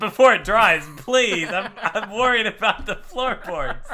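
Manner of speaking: high, loud mock pleading; high-pitched joking alarm through laughter; mock fretting. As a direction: fuss over the mess in hammed-up worry. Mocking a pirate fretting over floorboards.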